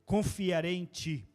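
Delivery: loud, slow scripture reading; preacher reading the psalm aloud to the church; psalm proclaimed. Real, not acted. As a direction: proclaim the psalm loudly and slowly.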